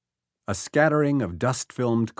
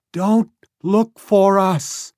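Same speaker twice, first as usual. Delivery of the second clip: high, loud, slow warning; calling out; 10 semitones higher, 8 dB louder, much slower than usual. A narrator's reading. Woman warns Becky, calling loud and slow. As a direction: warn them off, desperate. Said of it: "the woman called".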